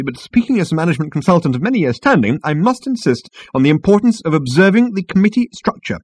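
high-pitched, officious insistence; huffy. A narrator's reading. Management consultant lectures Ford, huffily. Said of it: "said the man huffily".

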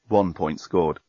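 plain reading voice

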